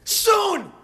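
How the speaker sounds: high shouted yelp; panicked shout. Retold or imitated. Tourist answering the cabbie in a panic. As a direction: blurt it out urgently.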